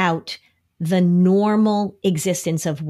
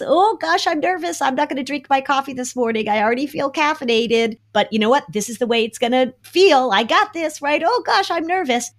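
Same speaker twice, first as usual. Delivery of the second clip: higher, jittery mock-nervous parent; 7 semitones higher than usual. Parent showing kids that worry is normal, mock nervous. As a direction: play up the jitters but stay game. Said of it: "You say to your kids".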